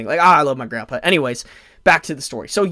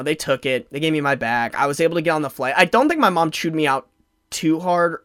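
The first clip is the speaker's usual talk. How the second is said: ordinary talk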